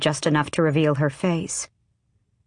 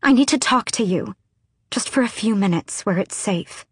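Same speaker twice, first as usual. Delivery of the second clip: high-pitched pleading, easing off; urgent plea; 4 semitones higher than usual. A narrator's reading. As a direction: plead urgently, starting high then softening. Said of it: "Lysandra said over the rain".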